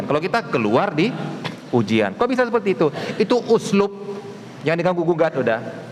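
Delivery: ordinary talk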